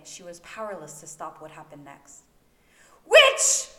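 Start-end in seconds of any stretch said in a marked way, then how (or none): 3.1-3.7 shrieked very high and very loud; screamed accusation. A narrator's reading. Merope shrieks at Hermione while clawing her throat. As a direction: scream it as you claw at her. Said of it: "Merope screamed".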